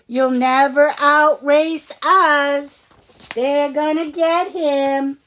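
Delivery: loud slow jeering; taunting. Acted. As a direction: sneer at him slowly. Bullies taunting.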